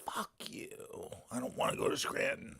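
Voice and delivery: hushed mock complaint under the breath; whispered grumble. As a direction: mutter it under your breath like a sulking worker. Retold grumbling about being sent.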